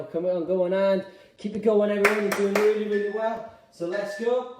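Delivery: calling out encouragement over a workout; rallying the class. Real, not acted. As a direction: urge everyone to keep going. Urging the class on mid-exercise.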